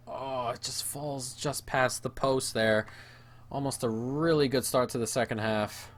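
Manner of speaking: usual speaking voice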